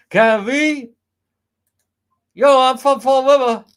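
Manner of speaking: high, loud mock Fall River voice; mocking Fall River accent. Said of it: "Ugh, that fucking voice".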